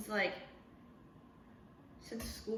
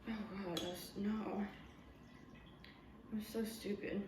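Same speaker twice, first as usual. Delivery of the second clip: ordinary delivery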